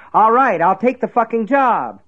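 high, loud desperate cry. A narrator's reading. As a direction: blurt it out loud in surrender. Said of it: "he cried".

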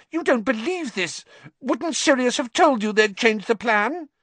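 high squeaky wheedling; wheedle, high and frantic; obsequious. Acted. Pettigrew pleads with Lupin.

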